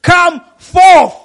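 loud ringing call, "come forth"; Jesus calling Lazarus from the tomb; loud cry. Acted. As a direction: command the dead man to come out. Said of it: "He cried out in a loud voice".

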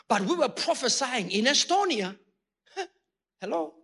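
usual speaking voice

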